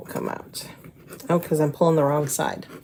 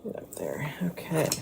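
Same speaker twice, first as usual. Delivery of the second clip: soft whisper to herself; 9 dB quieter than usual. Real, not acted. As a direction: breathe it under the breath. Whispering to the beads.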